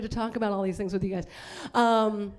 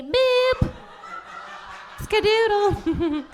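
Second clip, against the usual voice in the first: high, slow playful skadoodle; mock classy flourish; 9 semitones higher, 3 dB louder, much slower than usual. Comic miming classy pinky, high playful call. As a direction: say it with a dainty flourish. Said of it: "a pinky to show you're classy".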